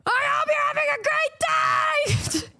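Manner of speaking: high-pitched screamed cheer; strained, very high mock scream; imitated fan scream. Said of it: "I would hear people screaming".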